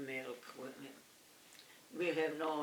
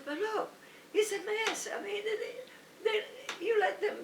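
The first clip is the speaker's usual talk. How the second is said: very high protest to the manager. Retold or imitated. Rita protests to the manager, very high. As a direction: plead your case pitched way up.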